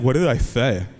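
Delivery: imitated child testing dad, lisped; lisping four-year-old. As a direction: challenge dad as a lisping little boy. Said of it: "he has a lisp right now".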